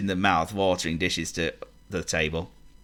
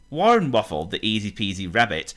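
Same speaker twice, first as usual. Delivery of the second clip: plain reading voice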